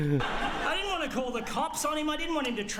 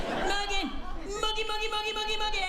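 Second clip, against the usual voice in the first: rapid high repeated call; calling a cat; 12 semitones higher, faster than usual. Retold or imitated. Calling Morgan again and again like a cat. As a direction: chirp the name over and over like calling a cat. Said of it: "Like I was calling a cat for its dinner".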